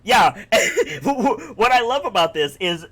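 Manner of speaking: normal talking voice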